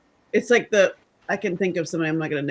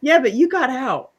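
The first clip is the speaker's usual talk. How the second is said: higher, dismissive mock voice; a hint of a condescending executive; imitating smug execs; 7 semitones higher, 4 dB louder than usual. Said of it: "And they're like".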